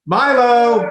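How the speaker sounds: loud high call upstairs; yelling across the room. Real, not acted. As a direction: call the dog from another floor. Calling dog from upstairs.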